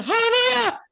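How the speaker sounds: high, loud church hallelujah; mimicked praise shout. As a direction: cry out praise like an excited congregant. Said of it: "He said".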